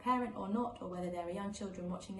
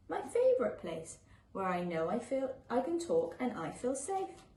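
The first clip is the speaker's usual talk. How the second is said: gentle, lilting picture-book read-aloud; reading to children; 4 semitones higher than usual. A narrator's reading. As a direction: read it like a bedtime story for little ones.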